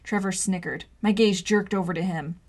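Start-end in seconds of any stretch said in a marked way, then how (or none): none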